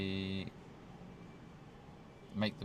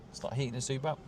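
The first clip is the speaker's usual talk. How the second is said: ordinary delivery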